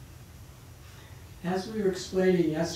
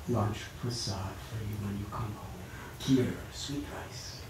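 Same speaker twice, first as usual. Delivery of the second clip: soothing mother; 6 semitones lower than usual. Acted.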